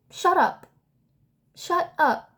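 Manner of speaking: high-pitched, exasperated dismissal; exasperated retort. Real, not acted. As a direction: shut her down, pitch jumping up then falling. Snapping back at quoted friend.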